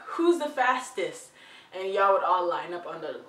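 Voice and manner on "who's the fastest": higher, louder, drawn-out playful challenge; imitating a kid. Kid challenging friends to a race, playfully calling out. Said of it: "you were a little kid and you'd be like".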